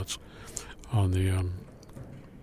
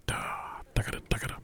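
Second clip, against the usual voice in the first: tagada whispered quick and crisp; 9 dB quieter than usual. Shown as a demonstration. Clinician to band repeating sixteenths, whispered. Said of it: "projection and the articulation of the sixteenth".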